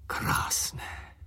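voiceless, hushed wonder; whispered marvel at a beautiful sight; awed whisper. A narrator's reading. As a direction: breathe the word out in a whisper. Awed recollection of erupting volcano.

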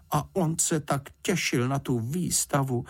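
pitying. A narrator's reading. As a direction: lament it for him, voice lifted. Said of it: "litovala Paddingtona paní Fousková".

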